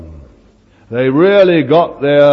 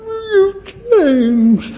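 much higher, slow, tearful voice; young woman telling the preacher he has changed, crying; 3 dB louder, slower than usual. Retold or imitated. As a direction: let it break as the young lady in tears.

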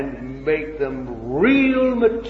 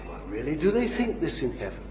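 mock doubtful quote; 3 semitones lower, 8 dB quieter, much faster than usual.

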